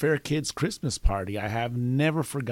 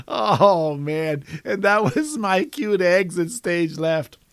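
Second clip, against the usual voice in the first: amused, laughing; 5 semitones higher, 5 dB louder, slower than usual. Real, not acted. Winding down from laughing hard.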